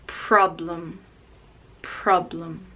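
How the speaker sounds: problem stressed on pro, blem reduced; problem, pro strong. Shown as a demonstration. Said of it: "the stress is on pro".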